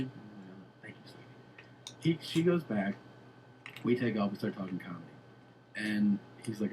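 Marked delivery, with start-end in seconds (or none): 0.4-2.2 very soft, half-whispered thanks; hushed sweet reply. Retold or imitated. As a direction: act out a shy little thank-you under the breath. Acting out sweet reply to girl.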